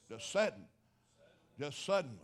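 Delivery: "sudden" and "suddenly" punched loud; preacher closing his point on "suddenly", loud and firm; stress on sudden. Shown as a demonstration. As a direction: hammer home "Just sudden". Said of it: "I wanna emphasize the word".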